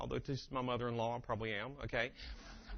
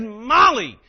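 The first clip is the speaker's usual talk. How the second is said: loud, high scolding call; exasperated dad shout; 9 semitones higher, 18 dB louder than usual. Retold or imitated. Dad losing patience with sleepy daughter, shouting. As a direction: shout the child's name, fed up.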